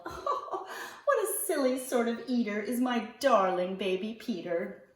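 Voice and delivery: fond little laugh through the words; chuckling softly. Acted. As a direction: chuckle to yourself over the baby. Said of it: "chuckle softly to herself".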